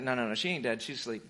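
gentle low no, no, no; soft reassurance. Retold or imitated. Jesus reassures mourners quietly.

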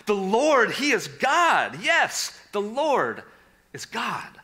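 loud, high cry of praise; crowd crying out. Acted. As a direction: shout it like the amazed crowd. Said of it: "They cried out".

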